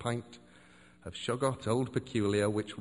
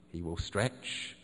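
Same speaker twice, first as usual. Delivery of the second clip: sleepy drawl; 6 semitones lower, much slower than usual. Acted. The shorter acolyte describing Cthulhu waking, low and slow. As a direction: stretch the words out lazily.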